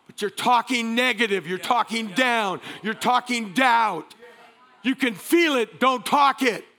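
rising pulpit exhortation; forceful, high-energy sermon voice; impassioned preaching. Real, not acted. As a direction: urge the congregation with driving emphasis.

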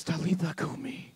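low soft tender call; hushed Aramaic command; soft Jesus command. Acted. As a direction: speak softly to wake her. Jesus speaks to dead girl.

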